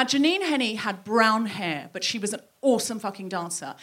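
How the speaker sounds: normal talking voice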